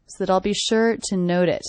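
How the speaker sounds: ordinary talk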